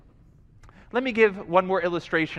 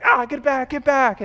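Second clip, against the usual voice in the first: higher-pitched startled warding-off; mock fear; 6 semitones higher than usual. Preacher acts out someone surrounded by hyenas, mock-afraid. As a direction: fend them off in mock alarm. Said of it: "you're afraid because of the hy".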